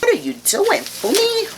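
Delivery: very high, put-on British voice; mock British accent. Vlogger catching herself mid-review, high mock British accent. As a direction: squeal it in a playful British accent. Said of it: "talk with a British accent".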